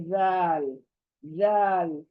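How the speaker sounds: जाल said softly, no jolt; soft zaal. Shown as a demonstration. Teacher showing students a soft जाल. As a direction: ease into जाल gently. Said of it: "एकदम नरमी से पढ़ना है".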